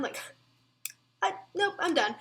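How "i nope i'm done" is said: "nope, I'm done" through a laugh, higher; giggling at her own panic. Real, not acted.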